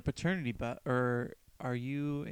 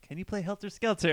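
higher, louder imitation of an eager request; imitated French fan; 7 semitones higher, 6 dB louder than usual. French fan shouting a request to the band, higher imitated voice. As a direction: call it up to the stage as the fan. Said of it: "It's this French guy who's like".

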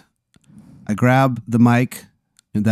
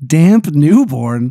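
slow, louder disbelieving Damp Newborn; incredulous repeat; 2 semitones higher, 5 dB louder, much slower than usual. Acted. Player repeating the executive's odd name, incredulous.

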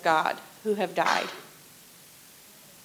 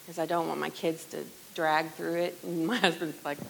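usual speaking voice

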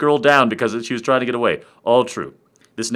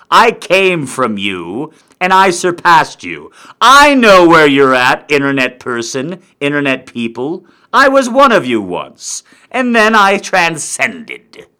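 slow, swelling mock-epic proclamation; grandiose indignation; 5 dB louder than usual. Comic scolding internet people he surpassed, pompous proclamation. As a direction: declaim it like a wronged god, stretching the big words.

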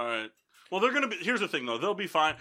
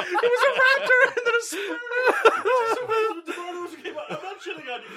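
high, laughing teasing; 8 dB louder than usual. Real, not acted. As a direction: tease through the giggles. Laughing at friend's wrong guess.

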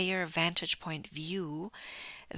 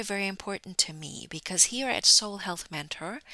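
usual speaking voice

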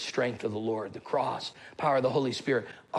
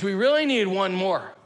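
put-on high, louder kid's plea; imitated insistent son; 6 semitones higher, 5 dB louder than usual. Son begging dad to join the game, imitated insistent plea. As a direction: press Dad in a raised, lightly voiced kid voice. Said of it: "He says".

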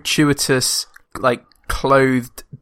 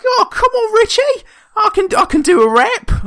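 very high, loud squawking kangaroo; cartoon kangaroo voice; 5 dB louder than usual. Imitating the film's kangaroo offering to rap, squeaky mock voice. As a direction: put on a ridiculous high-pitched talking animal. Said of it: "this kangaroo, he, he's like".

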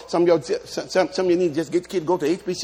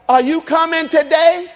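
stern parent; 10 semitones higher, 5 dB louder, slower than usual. Retold or imitated. Parent asking the kids.

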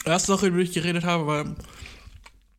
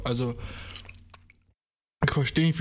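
usual speaking voice

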